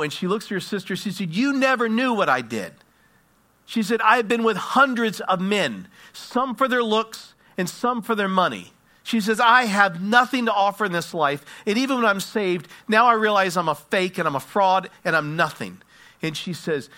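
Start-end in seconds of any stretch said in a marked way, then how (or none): 1.3-2.7 retold outburst, higher and louder; impassioned, raised-voice admission; anguished confession. Woman confronts her sister with confession. 4.0-8.7 high, emphatic retold outburst; anguished confession. Woman confesses her past to sister. 9.4-15.8 high, loud retold self-condemnation; impassioned, hammering self-accusation; despairing confession. Woman despairs of her own worth.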